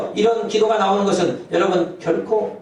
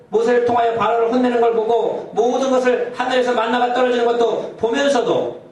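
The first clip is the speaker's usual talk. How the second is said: raised, urgent sermon build; fervent preaching; 6 semitones higher than usual. Acted. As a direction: drive the point home from the pulpit.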